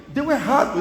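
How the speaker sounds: high, loud cry of frustration; exasperated outburst. Retold or imitated. Pastor complaining to God, a loud, high, exasperated cry. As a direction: throw it out, fed up with the failure. Retelling argument with the voice.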